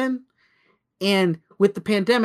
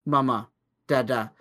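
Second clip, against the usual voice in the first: put-on lower, halting baby words; lightly voiced toddler babbling single words; toddler babble; 5 semitones lower than usual. Toddler's first words to her parents, lightly imitated babble. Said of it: "It would be like".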